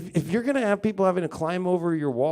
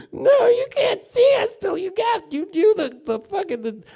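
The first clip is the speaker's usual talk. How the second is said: mock strict family; 7 semitones higher than usual. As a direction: lay down the rules in a shrill mock voice. Overcautious family forbidding visits.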